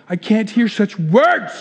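slow, scandalized protest, voiced aloud; mock horror. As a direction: draw it out in hammed-up horror. Same line repeated aloud, horrified.

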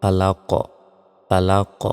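"ফালাক" with the last consonant popped; pausal pronunciation. Shown as a demonstration. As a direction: stop on "ফালাক" with an echoed final q. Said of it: "এখানে যদি থামি তাহলে উচ্চারণটা হবে".

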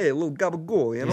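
high, mock Italian-American accent. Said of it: "in an Italian accent".

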